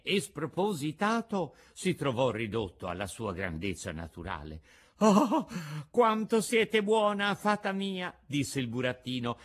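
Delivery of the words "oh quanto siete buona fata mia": high, loud, gushing puppet voice; grateful puppet. A narrator's reading. Puppet thanks the fairy after crying, gushing gratitude. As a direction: exclaim gratefully as the little puppet. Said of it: "asciugandosi gli occhi".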